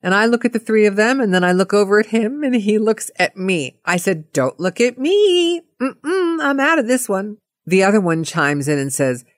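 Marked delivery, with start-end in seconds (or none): 4.3-7.4 high-pitched, backing out of it; light, lifted voice disowning the problem; hands-off refusal. Retold or imitated. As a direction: imitate ducking the argument. Retold refusal to get involved.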